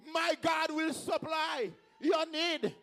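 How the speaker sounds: high, drawn-out preacher's shout; slow proclaiming shout. Real, not acted. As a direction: proclaim it slowly to the whole congregation. Repeats verse, building to shout.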